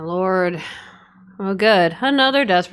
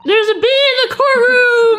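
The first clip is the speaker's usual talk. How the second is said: panicked shriek; 6 dB louder than usual. Pretend. Sudden bee panic.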